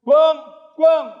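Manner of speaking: high, loud call of a name. Acted. Brothers calling their little brother to come eat, high and loud. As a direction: shout for him across the house.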